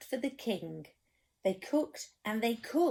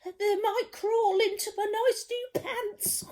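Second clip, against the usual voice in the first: high, trembling scared cook voice; 10 semitones higher than usual. Acted. Storyteller voicing the cook, scared of the sea, high voice. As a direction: quiver like a frightened wimp. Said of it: "the cook declared".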